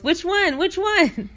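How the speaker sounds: high, flustered repeat; flustered Dave. Retold or imitated. The flustered tow driver answering Bernice, high and panicked. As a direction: squeak it out like a panicked helper. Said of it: "Dave goes".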